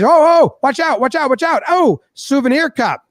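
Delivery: high-pitched warning cry; alarmed. Retold or imitated. Woman in bullpen spots flying trash, high alarmed voice. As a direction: act out a startled bystander calling a warning.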